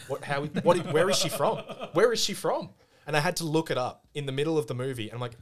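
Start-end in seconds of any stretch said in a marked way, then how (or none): 0.1-2.7 higher, louder incredulous questions through laughter; retold bafflement. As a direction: act out baffled disbelief, half laughing. Retelling bafflement to friend.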